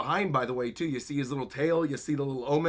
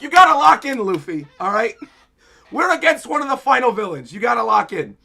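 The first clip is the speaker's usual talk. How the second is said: much higher and louder, pushing hard; urgent shout; 5 semitones higher, 9 dB louder than usual. Acted. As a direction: bark at him to focus now. Old man urges Luffy to focus.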